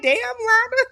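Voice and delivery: high-pitched, scandalized squeal of words; retold shock, voice leaping way up; shocked reaction. Host recalls reacting to Lana's bluntness, shocked and high-pitched.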